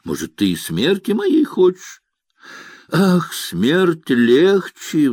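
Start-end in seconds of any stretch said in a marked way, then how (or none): 2.9-5.1 a long, drawn-out sigh on the words. A narrator's reading. Nina, jealous of her officer, sighs about death. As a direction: breathe it out slowly, heavy-hearted. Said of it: "вздохнула Нина".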